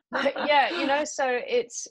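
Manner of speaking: real laugh spilling into the words. Real, not acted. Host joking after losing a guest from the call, laughing.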